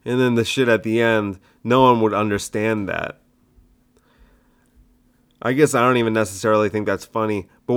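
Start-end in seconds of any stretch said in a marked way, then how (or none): none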